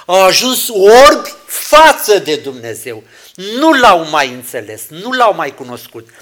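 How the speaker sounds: loud, emphatic sermon climax; a preacher's loud rebuke over spiritual blindness; preaching at full force. Acted. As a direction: thunder it at the congregation.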